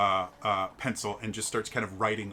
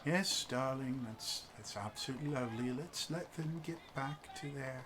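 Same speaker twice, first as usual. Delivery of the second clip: reserved husband; 3 semitones higher, 10 dB quieter than usual. Acted. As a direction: politely wrap things up.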